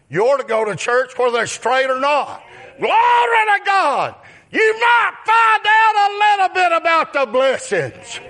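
rising to a high shout; preaching at full force. Acted. Preacher shouting to the congregation about church.

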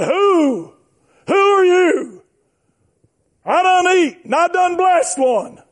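high, indignant preacher's retelling; startled challenge. Acted. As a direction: act out a father demanding an answer. Acts out father's startled challenge.

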